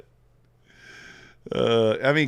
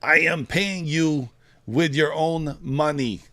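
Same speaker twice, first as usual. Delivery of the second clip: slow, high, put-on Russian accent from Rounders; bad Teddy KGB accent; 6 semitones higher, 4 dB louder, slower than usual. Streamer quoting the Rounders poker villain in Russian accent. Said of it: "I got a horrible accent".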